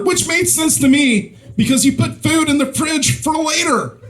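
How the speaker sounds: high-pitched, insistent defence; mock-indignant justification.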